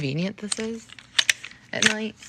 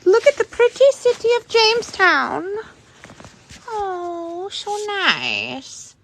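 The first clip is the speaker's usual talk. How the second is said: much higher, louder, cooing guide voice; gushing tour guide; 12 semitones higher, 7 dB louder, slower than usual. Acted. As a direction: coo over the view like a tour host.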